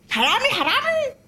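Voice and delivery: high panicked shouts of thief; old woman shrieking at an intruder; screaming thief. Acted. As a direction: scream for help at the burglar.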